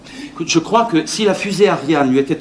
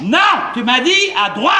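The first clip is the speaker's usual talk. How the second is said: raised-pitch protest; exasperated contradiction; 6 semitones higher than usual. Acted. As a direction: object hotly to the directions. Conteste les indications. (protestation agacée, voix montée)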